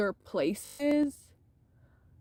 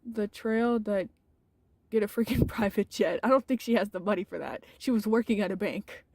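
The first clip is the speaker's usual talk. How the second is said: ordinary talk, nothing marked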